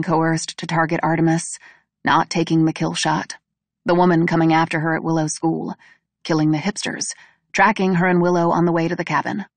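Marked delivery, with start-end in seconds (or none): none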